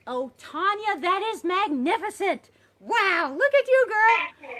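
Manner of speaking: high, loud delighted cheering; excited praise. Real, not acted. Instructor cheering her online student's breakthrough, delighted and loud. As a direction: burst out in thrilled praise for her.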